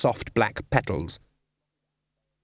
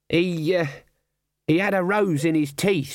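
higher, hesitant character voice; lightly voiced Nobby; 6 semitones higher than usual. A narrator's reading. Nobby telling the sergeant what he saw, higher and hesitant. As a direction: report it as a baffled underling.

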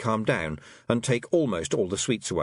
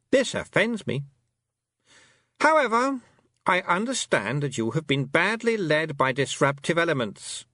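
affronted fairy queen; 8 semitones higher than usual. A narrator's reading. A fairy queen affronted by a rude girl. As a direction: act scandalised, icy sweet.

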